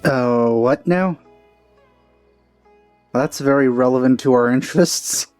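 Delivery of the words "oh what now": normal talking voice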